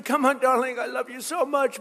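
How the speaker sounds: high, pleading cheer from the stands, hammed up; half-mocking mother's high-pitched cheer; mock screaming mother. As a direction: imitate a frantic mother urging her daughter on. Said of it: "the mother's screaming".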